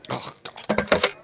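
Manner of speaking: whispered frustration; exasperated under breath. Acted. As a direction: breathe it out to yourself. Exasperated after Johnny leaves.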